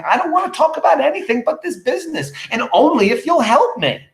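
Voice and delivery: high-pitched, worked-up acted refusal; exasperated insistence. Husband refusing his wife any other topic, exasperated.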